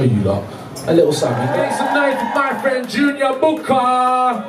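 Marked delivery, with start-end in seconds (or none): 1.5-4.4 slow, high hype-man call; announcer hype. Acted. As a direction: stretch it out like an MC. Host hyping crowd.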